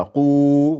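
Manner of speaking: rou with a long drawn-out ou; lengthened vowel. Shown as a demonstration. Teacher shows Nicolas how to lengthen rou. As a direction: stretch the ou in rou. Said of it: "Plus long encore".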